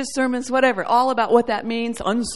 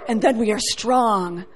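ordinary talk, nothing marked

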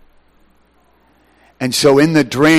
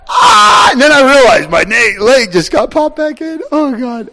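sudden tearful cry, much higher and louder; 11 semitones higher, 3 dB louder than usual. Real, not acted. Preacher overcome, crying out mid-sermon.

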